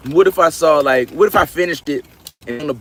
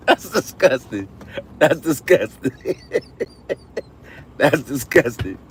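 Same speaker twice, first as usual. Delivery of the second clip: slow words between real laughs; laughing disgust; 3 semitones higher, slower than usual. Real, not acted. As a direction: say it slowly, cracking up. Laughing at a gross thought.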